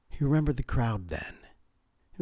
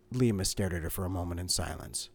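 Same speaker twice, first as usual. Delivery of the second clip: plain reading voice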